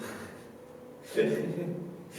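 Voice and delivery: soft, unvoiced giggling; breathy chuckle. Recalling dad's pool chlorine going boom, breathy chuckle. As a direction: let a quiet laugh escape.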